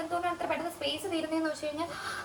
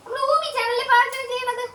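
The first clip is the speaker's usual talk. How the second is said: much higher, louder coo of നമ്മൾ; 12 semitones higher, 5 dB louder than usual. Real, not acted. Same line to baby.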